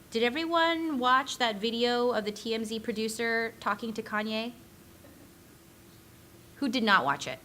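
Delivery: ordinary talk, nothing marked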